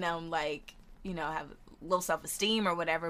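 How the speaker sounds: ordinary talk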